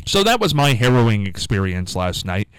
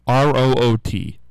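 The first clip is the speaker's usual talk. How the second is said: ordinary talk, nothing marked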